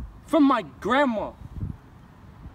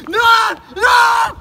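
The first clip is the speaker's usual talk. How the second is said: sudden loud high no no; panicked shout; 9 semitones higher, 10 dB louder than usual. Acted. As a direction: yell in panic as it goes wrong. Panicking mid-stunt.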